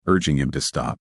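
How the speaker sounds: ordinary delivery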